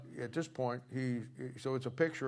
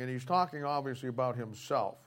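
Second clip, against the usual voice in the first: usual speaking voice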